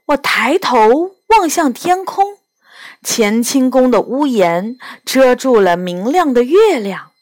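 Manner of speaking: plain reading voice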